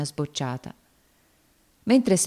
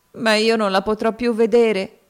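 put-on higher, louder sobbing girl; grieving cry; 6 semitones higher, 5 dB louder than usual. A narrator's reading. Topsy grieving for Eva, sobbing. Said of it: "esclamò Topsy".

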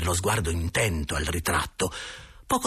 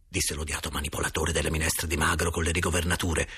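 ordinary delivery

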